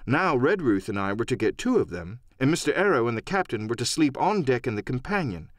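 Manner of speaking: ordinary delivery, nothing marked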